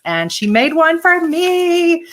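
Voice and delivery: rising high, drawn-out delight; excited. Real, not acted. Vlogger showing viewers a handmade gift, excited. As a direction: gush slowly, voice climbing with excitement. Thrilled about a gift made for her.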